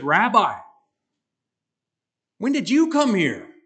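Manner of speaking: high, loud puzzled call; surprised questioning. Retold or imitated. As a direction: act out a crowd astonished to find their teacher. Acting the crowd's surprised question.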